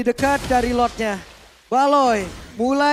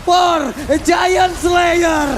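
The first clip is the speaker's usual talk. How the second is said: fighting game announcer; 3 semitones higher than usual. Real, not acted. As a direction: stretch the words like an arena announcer. Caster calling the game-winning push.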